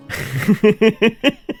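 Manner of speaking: short, high giggle; quick run of high chuckles, rising. Real, not acted. Gamer amused while exploring, short high giggle. Chuckling to self during play.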